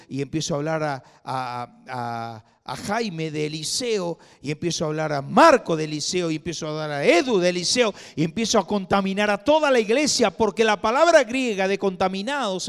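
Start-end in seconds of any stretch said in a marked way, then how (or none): 4.4-12.7 faster, louder with each line, peaking on toda; building preaching. Acted. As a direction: let it build to a shout. Sermon building to a climax.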